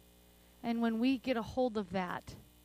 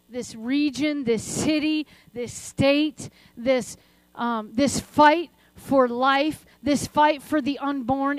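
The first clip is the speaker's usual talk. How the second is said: prayer swelling louder on the list of burdens; fervent prayer; 7 dB louder than usual. Acted. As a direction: pray with rising conviction, handing everything to God. Prays aloud to God.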